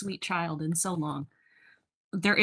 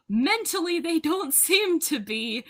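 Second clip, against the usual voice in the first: ordinary talk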